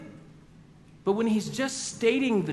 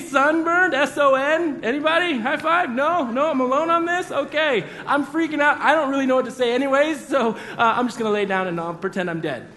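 high, loud, nervous rambling; awkward excitement; 6 semitones higher, 5 dB louder than usual. Retold or imitated. Peter fumbling for laughs after his bad pun, loud and jittery. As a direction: keep the pitch up, flustered that nobody laughed. Peter's joke falls flat.

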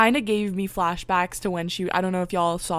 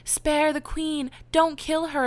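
higher, slow, pleading princess voice; imitating Aurora pleading; 8 semitones higher than usual. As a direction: plead slowly in a high princess voice. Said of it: "Aurora was like".

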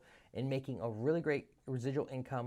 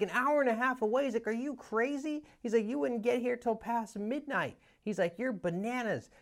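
astonished Ken; 11 semitones higher than usual. Retold or imitated. Ken hearing the caller will drive ninety minutes, very high astonished voice. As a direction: squeak out his shock at the drive.

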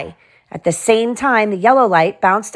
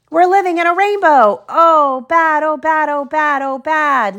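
lamenting; 8 semitones higher than usual. A narrator's reading. Carlos wailing about being trapped in the rainbow. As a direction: whine about being stuck inside.

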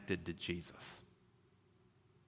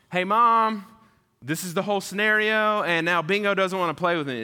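put-on much higher, louder child voice complaining; whiny kid to mom; 9 semitones higher, 7 dB louder than usual. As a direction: tattle to mom like an upset kid.

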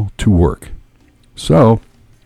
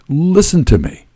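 higher, emphatic repeat; insistent; 9 semitones higher than usual. A narrator's reading. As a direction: press the point to be heard. Stranger insists, repeated line.

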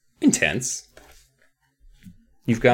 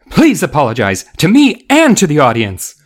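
higher, loud teacher's demand; 3 semitones higher, 6 dB louder than usual. A narrator's reading. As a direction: demand it indignantly. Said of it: "Missus Berman demanded".

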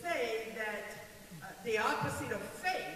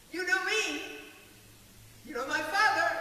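voicing Jesus; 7 semitones higher, 5 dB louder than usual.

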